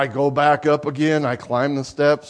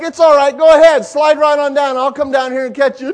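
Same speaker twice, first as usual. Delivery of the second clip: louder, higher reassurance called upward; coaxing reassurance; 9 semitones higher, 9 dB louder, faster than usual. Retold or imitated. Father below the slide coaxes his daughter, loud reassuring call. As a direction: call up encouragement to a scared child.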